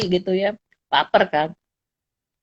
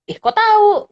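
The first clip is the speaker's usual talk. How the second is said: much higher, louder startled question; surprised; 10 semitones higher, 4 dB louder than usual. Retold or imitated. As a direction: caught off guard, amazed. Surprised the guard knows. (kaget karena satpam tahu soal dompet)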